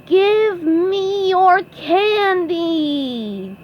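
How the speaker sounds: high, very slow ghostly wail; SpongeBob yelling. A narrator's reading. SpongeBob demands candy as a ghost, long high wail. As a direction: draw every word out to spook them. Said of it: "yelled SpongeBob".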